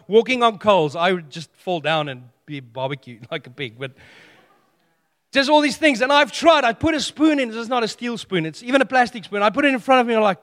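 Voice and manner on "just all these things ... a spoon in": raised voice, higher and louder; shouted. Real, not acted. Pastor recalling his spoon bending, shouting. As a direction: call it out with full effort.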